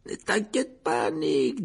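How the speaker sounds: high, drawn-out mocking reassurance; teasing ghost. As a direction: tease him in a slow high voice. Severed head teases the host.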